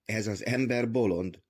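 ordinary delivery

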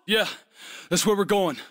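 ordinary talk, nothing marked

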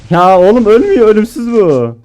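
high, loud frantic complaint; frantic exclaim. Real, not acted. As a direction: yell it in panic as he won't die. Enemy won't die.

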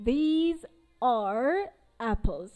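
very slow, every word of these are apples drawn out; slow model sentence. Shown as a demonstration. Teacher slowly reads the plural example to students. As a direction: spell it out slowly for the class. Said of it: "پس فعلمون هم باید جمع بشه".

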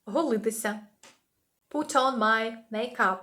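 usual speaking voice

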